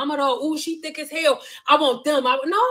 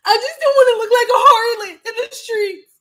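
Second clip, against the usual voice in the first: high, loud, hammed-up crying whine; mock sobbing plea to her husband, high and loud; whiny mock wail; 10 semitones higher, 7 dB louder than usual. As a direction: wail it like a fake-sobbing drama queen. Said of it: "And I'll be like".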